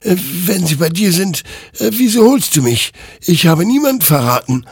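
high, loud pushback; loud, high indignant denial; defensive protest. A narrator's reading. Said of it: "wehrte sich Hector".

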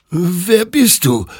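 high, drawn-out whimper; wimmernd. A narrator's reading. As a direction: whimper it, slow and high-pitched. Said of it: "wimmerte Hector". (hohes, gedehntes Wimmern)

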